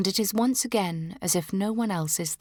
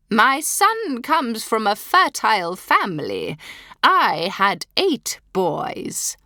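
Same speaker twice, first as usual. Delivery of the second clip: louder, higher, slow haughty boast; proud duchess; 5 semitones higher, 6 dB louder, slower than usual. Acted. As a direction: boast with cold pride.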